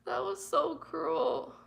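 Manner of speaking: high, slow, near tears; real tearful disbelief. Real, not acted. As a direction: push it out through the hurt. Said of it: "It was bad".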